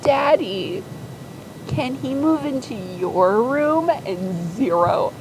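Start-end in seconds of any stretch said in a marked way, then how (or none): 0.0-3.9 higher, sugary daddy plea; butter him up, sickly sweet and high; saccharine sweet. Retold or imitated. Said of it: "so saccharinely and is like".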